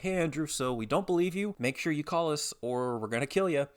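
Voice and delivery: high, mock-polite official voice; fake-friendly threat. Insurer doubting his uploaded document, imitated fake-friendly threat.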